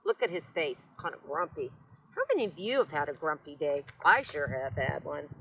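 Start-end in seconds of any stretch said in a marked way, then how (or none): none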